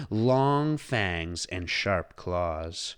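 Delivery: ordinary delivery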